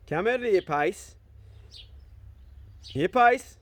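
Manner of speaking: calling the dog. Real, not acted. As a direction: call the dog over, voice lifting on the name.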